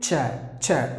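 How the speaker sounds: "cha, cha" with a clear ch sound. Shown as a demonstration. Teacher models "cha" for students. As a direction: say "cha" crisply, twice. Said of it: "is pronounced like cha".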